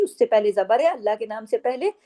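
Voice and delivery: ordinary delivery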